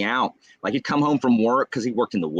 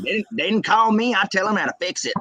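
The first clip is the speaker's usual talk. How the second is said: higher-pitched indignant Southern dad; dad's voice; 8 semitones higher, faster than usual. Retold or imitated. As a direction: imitate a dad sure he knows better. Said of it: "that's how my dad talks".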